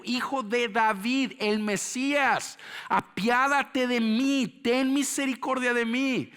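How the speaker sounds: high, loud, impassioned sermon; full-force preaching. Acted. Preacher tells congregation the beggar had no merit, full-force preaching. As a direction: drive each phrase out at a shout.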